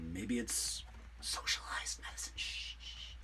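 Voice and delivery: whispered joke with a shushing; hushed whisper. Real, not acted. Joking to viewers that socialized medicine is a secret, whispered. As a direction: hush it like a secret.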